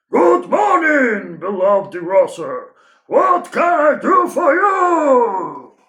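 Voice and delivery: high, loud, slow shout; booming grocer. Acted. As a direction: bellow the greeting at him. Loud grocer greets customer.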